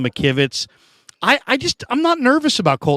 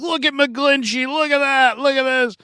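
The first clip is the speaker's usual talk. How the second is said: much higher, jeering mock voice; mocking critics; 10 semitones higher than usual. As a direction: imitate fans pointing and sneering. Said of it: "and people are like".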